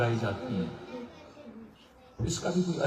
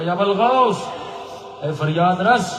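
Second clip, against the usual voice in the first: loud, high plea to the saint; desperate calling out; 4 semitones higher, 5 dB louder, slower than usual. Retold or imitated. Bedouin father begging at the shrine, loud desperate call. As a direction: cry out for help, pitched up and loud.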